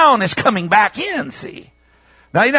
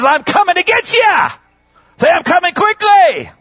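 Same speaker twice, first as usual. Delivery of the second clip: high, impassioned preacher's cry; fervent proclamation; 10 semitones higher than usual. Real, not acted. Preacher urging congregation to repeat the promise, high fervent cry. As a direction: cry out the promise to rouse them.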